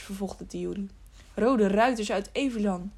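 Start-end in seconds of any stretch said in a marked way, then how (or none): none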